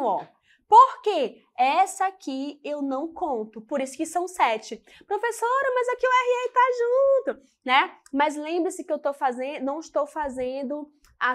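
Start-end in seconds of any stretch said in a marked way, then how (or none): 5.1-7.4 high-pitched mock pupil voice; imitated student objection. Pupil questioning the teacher about joined letters, high imitated voice. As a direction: pipe up like a pupil querying the teacher.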